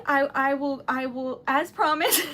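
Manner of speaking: real flustered stammer breaking into a laugh; flustered stuttering. Real, not acted. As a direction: stumble and restart the phrase. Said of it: "I'm stuttering".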